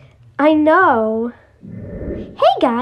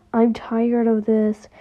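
ordinary delivery

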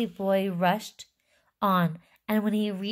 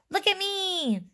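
excited shout; 12 semitones higher than usual. A narrator's reading. Boy running to the camera, high excited shout. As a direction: call out high to be watched. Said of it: "he shouted as he ran".

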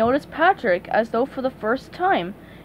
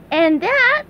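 SpongeBob voice; 12 semitones higher, 5 dB louder than usual. Acted. SpongeBob ends show.

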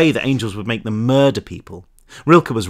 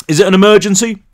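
higher, louder official questioning; raised, loud phone-man voice; 8 semitones higher, 8 dB louder than usual. A narrator's reading. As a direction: demand it like an officious call-taker. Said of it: "the voice said".

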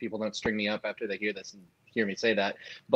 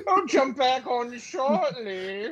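much higher, slow mock villain voice; maniacal evil genius; 13 semitones higher, much slower than usual. Friend echoes the message as an evil genius. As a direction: stretch it out like a sixties cartoon mastermind. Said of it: "a maniacal evil genius in some nineteen sixties".

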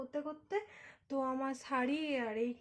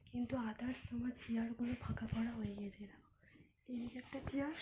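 whisper; 6 dB quieter than usual. Real, not acted. Mother whispering to viewers while the baby sleeps. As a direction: whisper it under your breath. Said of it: "আস্তে আস্তে কথা বলছি".

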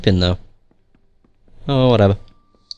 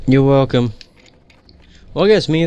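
ordinary talk

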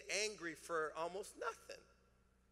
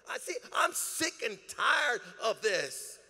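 hammed-up tantrum, much louder and higher, near a shout; furious exit; 6 semitones higher, 10 dB louder than usual. Pastor acts out storming away from Lisa in a mock loud rage. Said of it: "blow up and say something like this".

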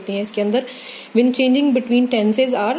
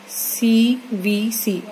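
"CVC" slow, one letter at a time; "CVC" said slowly, letters set apart for learners; spelled out slowly; much slower than usual. Shown as a demonstration. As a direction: draw out each letter of "CVC". Teacher repeats the letters for students.

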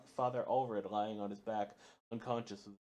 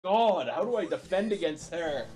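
loud, high gamer frustration; very loud, high-pitched exasperation; exasperated yelling; 8 semitones higher, 12 dB louder, slower than usual. Real, not acted. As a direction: complain at full volume about an unbeatable enemy. Losing to game enemy.

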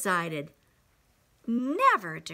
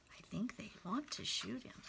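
much softer, fast hushed whisper; worried whisper; 2 semitones lower, 16 dB quieter, faster than usual. Real, not acted. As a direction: whisper it as if the hunters might hear. Storyteller aside, hunters approaching.

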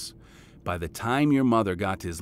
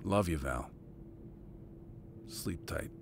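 tender goodnight; 3 semitones lower, 9 dB quieter than usual. Acted. Father tucks sleeping daughter in.

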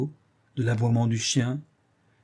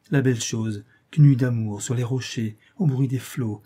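high, loud ardent pleading; passionate begging; 4 semitones higher, 4 dB louder than usual. Acted. Girl begging Arthur for a night meeting, high passionate plea. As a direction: promise him everything, begging.

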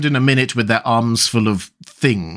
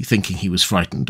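ordinary delivery

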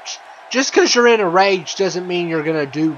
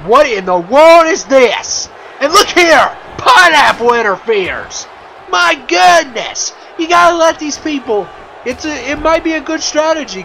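excited shouting commentary; 6 semitones higher than usual. Real, not acted. Commentator stunned by Pineapple's interference, shouting higher. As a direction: sell the surprise interference big.

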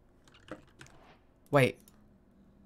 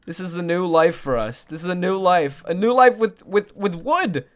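usual speaking voice

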